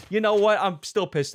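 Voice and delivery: angry outburst. Real, not acted. Angry outburst about graduation.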